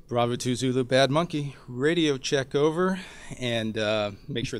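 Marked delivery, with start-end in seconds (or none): 0.1-3.0 louder, more insistent radio check; calling again, getting impatient; urgent radio call. Shown as a demonstration. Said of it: "So I key the handset".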